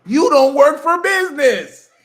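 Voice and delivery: high, loud and slow comeback; shouting the punchline back; loud retort. Real, not acted. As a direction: slam the correction home. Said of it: "punchline retort".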